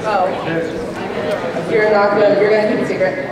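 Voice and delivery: ordinary delivery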